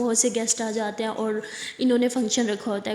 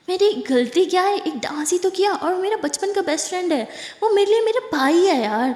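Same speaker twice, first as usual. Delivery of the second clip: defensive pleading; 8 semitones higher than usual. Acted. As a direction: argue your innocence, pitched high and pressing. (اونچی، بلند، دھیمی رفتار سے صفائی دیتی آواز)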